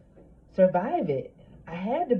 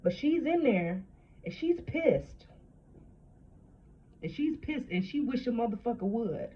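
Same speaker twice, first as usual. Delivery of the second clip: fierce; 5 semitones higher than usual. Real, not acted. Telling women their inner girl is angry, fierce and fired up. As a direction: let the anger rise as you say it.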